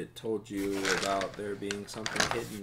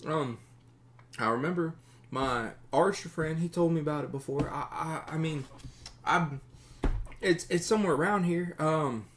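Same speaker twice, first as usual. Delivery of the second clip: high-pitched, stammering simpleton voice; nervous captive bandit; 7 semitones higher, 4 dB louder than usual. Acted. Captive bandit explains himself to his captors, high and halting. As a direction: stumble anxiously through an explanation.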